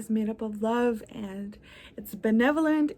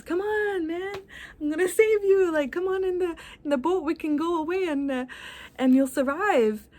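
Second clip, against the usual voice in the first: high-pitched eager rescuer calling from a rowboat; 6 semitones higher than usual. Acted. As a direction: pitch it up high, like someone urging a stranded man aboard. Rowboat rescuer voiced in story.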